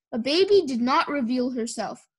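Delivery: usual speaking voice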